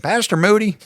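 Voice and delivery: high, loud mock church lady; put-on lady's voice. Lady approaching the pastor after preaching, in a high put-on voice. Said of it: "I don't think that was her voice".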